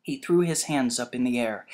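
usual speaking voice

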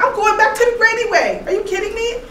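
high-pitched retold exasperation; acting out a mildly mad outburst; mock frustrated. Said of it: "I got frustrated and mad".

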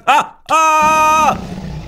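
high loud yelp; startled yelp. Real, not acted. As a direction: cry out as the dragon hits. Dragon swoops.

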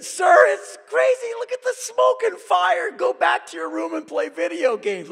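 mock alarmed soldier; panicky soldier. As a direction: shriek the warning like a frantic soldier. Soldiers at gate warn him.

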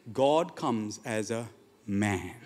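low, soft and very slow; hushed revelation. Real, not acted. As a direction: let the truth land word by word. Hushed revelation after impassioned questions.

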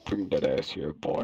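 put-on low, leering creepy old man. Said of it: "do the creepy old man voice".

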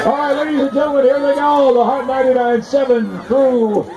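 announcer voice. Real, not acted. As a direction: build it up for the crowd. Announcer building to the jump.

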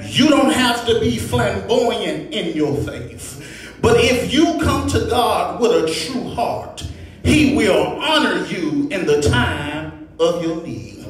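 ordinary delivery

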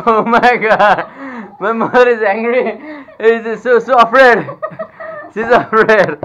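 can't stop laughing at the chaos; laughing excitedly. Real, not acted. Watching her mother back away from the angry dog, laughing loudly.

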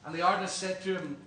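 normal talking voice

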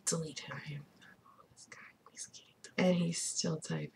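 unvoiced whisper; conspiratorial whisper. Real, not acted. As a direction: whisper it to your viewers. Whispered warning to viewers.

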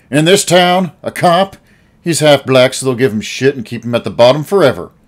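loud, high, heated outburst; sudden anger. A narrator's reading. Old Al angrily arguing with Uncle Lou, heated. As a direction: spit out the bitterness. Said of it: "burning with sudden anger".

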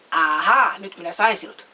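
loud, gloating villain's "aha"; storybook villain's menacing bellow; terrible roar. A narrator's reading. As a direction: roar in triumph at your catch. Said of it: "karjasi Heipparalla hirmuisella äänellä".

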